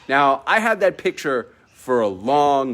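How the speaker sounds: ordinary delivery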